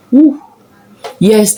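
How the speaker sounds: normal talking voice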